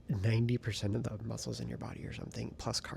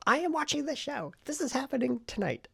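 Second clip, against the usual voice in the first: excited; 5 dB louder than usual. Real, not acted. Vlogger announcing tonight's plan.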